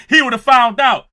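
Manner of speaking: loud laughing punchline. Real, not acted. Host cracking up at chat story. Laughing at his own joke.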